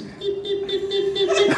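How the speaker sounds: very high electronic beeping; imitated device beeps. Translator keys a word into her device, imitated high beeps. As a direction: mimic a little gadget's high, even beeps. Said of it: "She comes up to this and goes".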